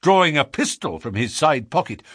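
plain reading voice

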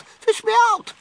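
put-on very high, loud boy in distress; high-pitched drowning plea; yelling. A narrator's reading. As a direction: yell desperately to be rescued. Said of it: "he yelled".

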